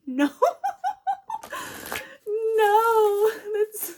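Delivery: high squealing laughter; laughing hard. Real, not acted. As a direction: crack up laughing. Cracking up after the impression.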